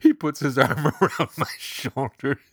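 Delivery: can't stop laughing while telling it. Acted. Girl mortified at a funeral, laughing through every line.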